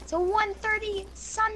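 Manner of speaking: high, slow cocky taunt. Real, not acted. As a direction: drag it out, gloating. Taunting over a trade.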